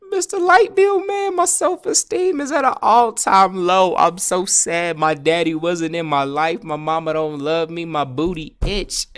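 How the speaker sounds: high, loud, hammed-up whining; mock-pitiful pleading. Customer heaping sad excuses on Mister Light Bill Man, hammed-up high pleading. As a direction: pour out fake woes in a slow, high voice. Exaggerated acted excuse-making.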